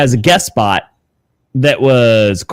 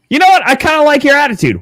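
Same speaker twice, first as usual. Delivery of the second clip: approving surprise; 12 semitones higher, faster than usual. Retold or imitated. Big guy voiced warmly approving.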